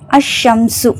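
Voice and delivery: அஷ்ஷம்சு with the l silent, doubled sh; no l sound in அஷ்ஷம்சு, just a long sh; silent lam. Shown as a demonstration. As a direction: run straight into a held sh in அஷ்ஷம்சு. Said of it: "லாம் உச்சரிக்கப்படலை".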